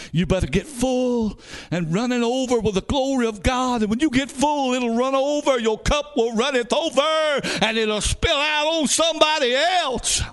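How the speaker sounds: high-pitched preaching building to a shout; fervent preaching. Real, not acted. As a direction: climb in pitch to a shouted climax. Preaching at full force.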